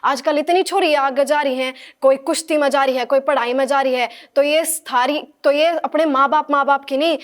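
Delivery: loud Haryanvi political speech; booming public oration with Haryanvi accent; Modi speech in Haryanvi. Acted. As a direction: address the rally in Haryanvi, projecting. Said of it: "जो हरियाणवी में होनी चाहिए".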